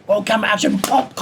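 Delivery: high, loud mock director's call; calling action. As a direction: re-enact the call for a take, ending on a punched sound effect. Re-enacts director's call and punch.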